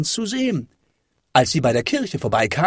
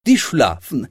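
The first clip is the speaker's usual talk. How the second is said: plain reading voice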